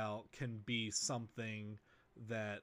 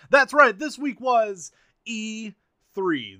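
excited; 9 semitones higher, 13 dB louder, slower than usual. Real, not acted. Big reveal of E3 week.

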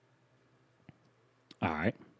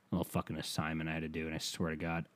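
very soft, quick, low grumble; real irritated mumble to yourself; muttered aside; 5 semitones lower, 15 dB quieter, faster than usual. Real, not acted. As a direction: mutter it under your breath. Grumbles aside about the assignment.

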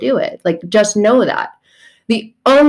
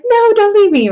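much higher, louder clingy wail; mock desperate plea; 14 semitones higher, 5 dB louder than usual. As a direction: beg like a dumped lover, hammed-up. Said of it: "And you're like".

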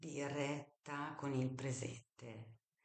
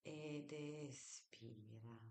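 slow, soft breathing cue; guided breathing; 12 dB quieter, much slower than usual. Shown as a demonstration. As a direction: draw out the exhale gently. End of a breathing exercise.

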